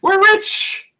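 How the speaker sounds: very high-pitched mock jubilation; ecstatic cheer. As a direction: squeal it like you struck gold. Natives rejoicing in found money.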